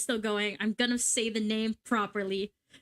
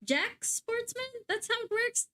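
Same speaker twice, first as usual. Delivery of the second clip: doubtful high query; 10 semitones higher than usual. Real, not acted. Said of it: "Fucking hell".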